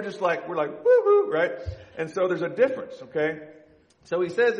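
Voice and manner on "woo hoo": much higher, louder whoop; mock cheer. Pastor voicing oblivious husbands, high loud cheer. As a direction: whoop like a carefree fan.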